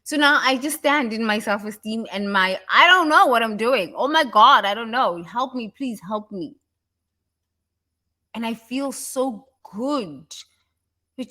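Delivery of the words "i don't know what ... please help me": higher, louder, whiny helpless plea; mock helpless. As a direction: play the clueless one begging for help.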